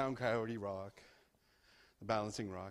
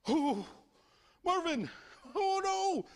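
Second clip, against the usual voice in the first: high, louder panicked call; frightened cry; 3 dB louder, slower than usual. Acted. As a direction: cry out for your lost brother. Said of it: "Shia is scared".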